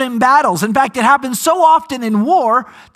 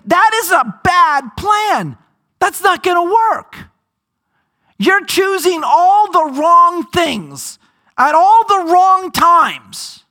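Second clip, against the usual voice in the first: scoffing objection; 7 semitones higher, slower than usual. Retold or imitated. Pastor voicing skeptical humans, exclaiming in disbelief. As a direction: protest like a doubter who thinks it is foolish. Humans judging God's plan.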